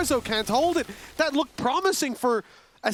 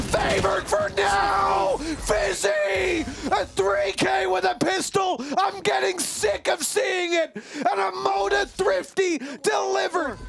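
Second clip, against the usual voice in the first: high hype yell; excited shout; 5 semitones higher than usual. Real, not acted. Caster hype call.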